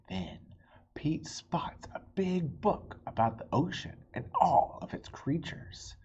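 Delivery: gentle storybook reading voice; story narration. A narrator's reading. Reading a picture book to young listeners, gentle narration. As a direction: read it like bedtime story time.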